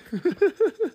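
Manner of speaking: high laugh. Real, not acted. Laughs at own joke.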